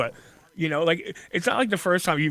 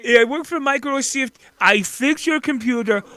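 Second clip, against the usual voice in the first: higher, slow, louder scam-call voice; mock scam caller; 7 semitones higher, 5 dB louder than usual. Host mocking a scam caller who claims to work for Microsoft. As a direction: play the phony tech-support caller. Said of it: "that phone call".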